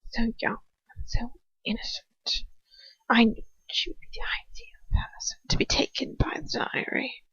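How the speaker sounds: hushed, savouring villain whisper; menacing whisper. A narrator's reading. Villain gloats over the girl in a menacing whisper. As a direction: breathe it like a secret you enjoy.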